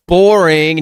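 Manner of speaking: high, loud drawn-out jeer; loud mock heckle. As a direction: call it out in exaggerated boredom. Texting as girlfriend, bored of gentleman.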